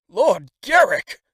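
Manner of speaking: high, loud, slow astonishment; surprised exclaim. A narrator's reading. Brother hears the dying man's name, astonished. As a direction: repeat the name in disbelief. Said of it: "said my brother".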